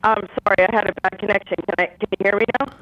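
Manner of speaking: garbled, bubbling bad phone line; words sound like gargling through the phone. Real, not acted. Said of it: "you sound like you're gargling".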